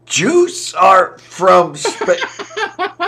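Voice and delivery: laughing. Real, not acted. Laughing mid-sentence while chatting.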